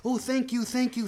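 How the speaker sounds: high, gushing thanks; grateful bear. Acted. Bear warmed by the fire thanking mommy, high grateful voice. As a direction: lift the pitch in gratitude.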